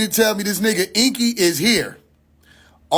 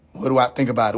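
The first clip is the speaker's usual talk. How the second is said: much lower, falling question; low echoed question; 8 semitones lower, faster than usual. Real, not acted. Streamer echoing what everybody asks him, low and incredulous. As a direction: throw the question back in a low voice. Throws the asked question back.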